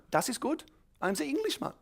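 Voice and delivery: high-pitched, pleased German teacher; mock German accent. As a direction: confirm it in a put-on German accent. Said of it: "everyone thought I was a German".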